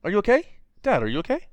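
high, loud hammed-up voices; mock comic dialogue. Reading cheesy panel dialogue aloud, high hammed-up. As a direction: ham up the cheesy comic lines.

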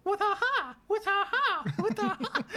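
high shrill mock battle cry, repeated; mock fighter battle cry. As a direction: squeal it like a video game fighter. Mocking taunt while winning.

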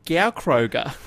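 Gaukroger said drawn out as "Gow-kroh-ga"; mangled Gaukroger, with GOW up front; announcer's mispronunciation. Retold or imitated. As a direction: read out Gaukroger slowly, wrongly, as "Gow-kroh-ga". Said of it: "they probably mean Gaukroger".

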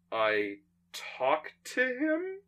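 slow, high, soft questioning; puzzled answer. Acted. Nick telling the Doctor how he reaches Santa, puzzled. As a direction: draw it out, unsure why it's being asked.

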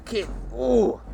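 usual speaking voice

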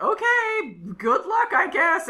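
high, loud mock-chipper send-off; Miss Minutes, chipper. Podcaster voices the character giving up on the pair, high and chipper. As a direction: imitate a perky character brushing someone off. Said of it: "Miss Minutes is like".

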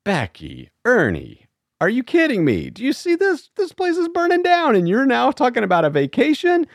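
mock the frantic boss who can't believe the request; imitating an exasperated manager. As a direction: manager scoffing at staff asking for holiday time, high and incredulous. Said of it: "these managers are gonna be like".

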